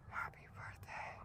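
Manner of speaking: eerie ghostly whisper. Retold or imitated. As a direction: breathe it like an unseen ghost. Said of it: "I hear something say".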